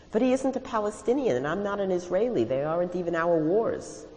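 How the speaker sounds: high-pitched, insistent objection; indignant protest. Acted. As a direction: argue back against the lecture. Narrator acts out protesting to mentor.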